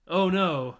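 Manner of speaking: laughing through the words. Real, not acted. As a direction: crack up while saying it. Laughing at the scene.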